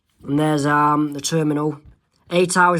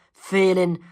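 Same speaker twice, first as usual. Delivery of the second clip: ordinary talk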